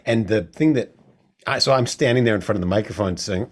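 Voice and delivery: ordinary delivery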